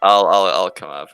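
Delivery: ordinary delivery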